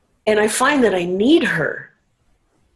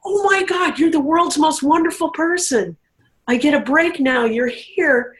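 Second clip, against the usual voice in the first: sudden high burst of joyful relief, easing down; overjoyed relief; 6 semitones higher than usual. Retold or imitated. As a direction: gasp with delight at the rescue.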